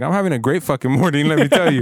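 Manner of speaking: overly cheery. Retold or imitated. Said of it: "And I was like".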